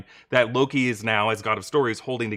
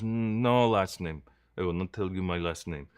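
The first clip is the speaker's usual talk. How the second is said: put-on Victor, Russian accent; 5 semitones lower, 5 dB quieter than usual. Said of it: "just doing a Russian accent".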